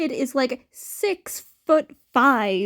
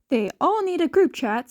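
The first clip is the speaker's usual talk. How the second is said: ordinary delivery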